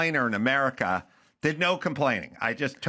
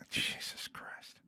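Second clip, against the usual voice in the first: breathy whisper of disbelief; whispered aside; whispered exasperation; 15 dB quieter, much slower than usual. Real, not acted. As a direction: whisper it to yourself, defeated. Aside after broccoli reveal.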